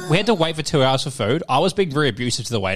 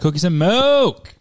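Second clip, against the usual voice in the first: announcing; 5 semitones higher, 5 dB louder, much slower than usual. Real, not acted. Announcing the drink.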